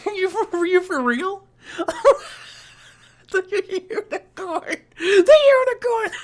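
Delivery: high, laughing disbelief. Real, not acted. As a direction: laugh through the words in disbelief. Laughing disbelief at unicorn pick.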